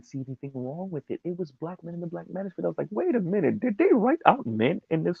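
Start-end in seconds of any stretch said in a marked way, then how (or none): 2.9-5.2 high, loud incredulous outburst; loud retold double take; mock disbelief. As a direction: exclaim it in raised-pitch disbelief. Acting out his startled objection.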